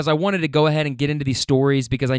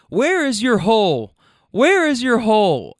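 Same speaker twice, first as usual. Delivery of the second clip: insistent friend; 7 semitones higher, 4 dB louder than usual. Retold or imitated. Friend helping her buckle up, mock insistence. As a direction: imitate a friend asking higher and insistently.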